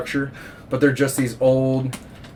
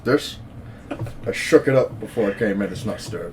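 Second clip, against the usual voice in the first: low, soft Connery impression, evasive; 4 semitones lower, 6 dB quieter than usual. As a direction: answer quietly and slyly, drink in hand. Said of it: "Sean, put the drink down".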